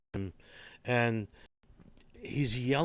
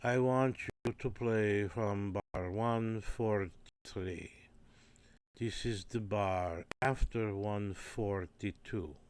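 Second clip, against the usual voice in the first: put-on slow, deliberate Hungarian-accented English; a careful foreign conductor explaining; lightly done Hungarian accent; much slower than usual. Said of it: "he would say things like".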